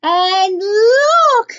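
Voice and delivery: high, very loud cub's wail; Baby Bear, wailing. A narrator's reading. Baby Bear points out who is in his bed, loud wail. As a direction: cry it out loud like an upset cub. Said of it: "wailed Baby Bear".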